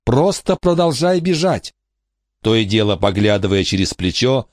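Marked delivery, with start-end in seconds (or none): 0.0-1.7 high, strained voice urging herself on; desperate self-urging. Acted. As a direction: beg yourself to keep running. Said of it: "твердила она себе".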